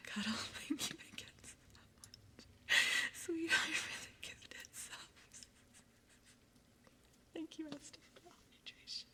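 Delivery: whispering. Acted. ASMR whisper.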